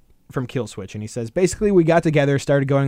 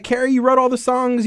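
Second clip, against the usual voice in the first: pitched up, higher, scoffing at Kerry; mock scolding; 10 semitones higher, 3 dB louder than usual. Fan mocking Kerry King for hogging songwriting, pitched up scoff. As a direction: needle him like a fed-up fan.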